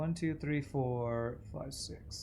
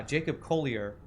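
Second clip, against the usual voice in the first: normal talking voice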